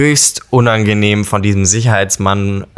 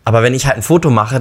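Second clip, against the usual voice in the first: normal talking voice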